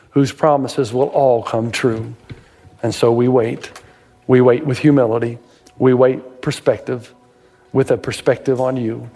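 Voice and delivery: pastor praying over the listeners; prayer. Real, not acted. Closing prayer.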